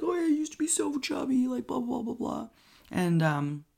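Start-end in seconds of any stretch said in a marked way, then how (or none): 0.0-1.5 mocking him in a much higher, put-on voice; smug brother-in-law. Narrator mocks Trey bragging to family, high mocking voice. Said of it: "Trey's kind of an asshole". 1.5-2.5 mock blather. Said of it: "He's just like".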